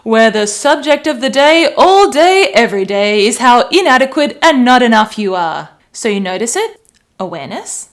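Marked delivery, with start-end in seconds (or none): 0.0-5.7 loud, punchy mock talk-radio rant; nasty radio host. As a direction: hammer it like a nagging shock jock. Mocking the nasty talkback radio.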